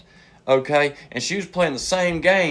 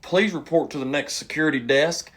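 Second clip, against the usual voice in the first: public-address page with a stretched-out name; mock intercom announcement. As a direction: imitate a casino paging announcement. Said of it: "all of a sudden I hear".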